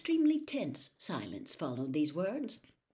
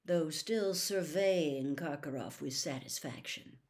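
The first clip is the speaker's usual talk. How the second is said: usual speaking voice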